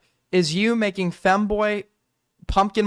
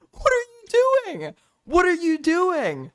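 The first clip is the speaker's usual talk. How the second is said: shocked disbelief; 12 semitones higher than usual. Real, not acted. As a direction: ask it in pure disbelief. Shocked at clip.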